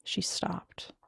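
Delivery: ordinary delivery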